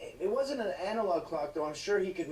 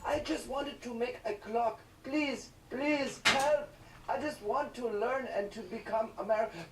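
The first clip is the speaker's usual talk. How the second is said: put-on pleading boy; 5 semitones higher than usual. Boy pleading with the cops, hammed-up begging. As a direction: beg like a helpless kid. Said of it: "And he's like".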